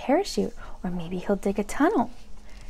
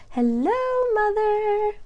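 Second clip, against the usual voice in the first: ordinary delivery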